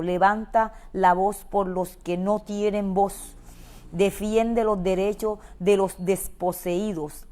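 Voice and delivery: loud, driving proclamation of the verse; fiery preaching. Acted. Preacher urging the congregation to speak up, full force. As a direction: preach it out to rouse the church.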